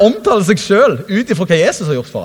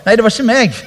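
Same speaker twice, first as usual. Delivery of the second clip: mock denial; 3 semitones higher than usual. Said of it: "og så bare".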